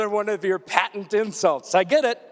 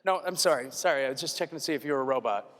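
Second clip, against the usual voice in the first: fast; 7 semitones lower, 9 dB quieter, much faster than usual. Real, not acted. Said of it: "x2.0".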